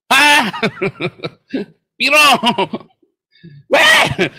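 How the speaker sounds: high, loud startled-sounding yells; market shouting. Acted. Buyer bargaining for fish at the market, shouting. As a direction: yell out as at a noisy fish market. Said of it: "Kalau lagi beli ikan di pasar, bisik-bisik".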